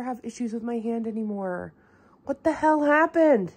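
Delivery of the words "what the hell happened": shocked. Real, not acted. Reacting to stained hand.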